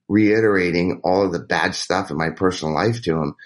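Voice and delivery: ordinary delivery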